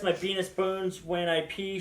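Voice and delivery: ordinary talk, nothing marked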